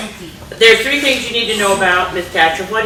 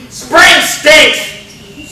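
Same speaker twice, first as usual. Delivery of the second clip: loud, higher grumbling bear; loud cranky complaint; grumpy shout; 7 semitones higher, 4 dB louder than usual. Acted. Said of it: "says Bruce".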